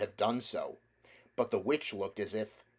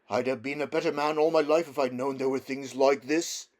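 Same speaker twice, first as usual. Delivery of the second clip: moved cabby; 4 semitones higher, 5 dB louder than usual. Acted.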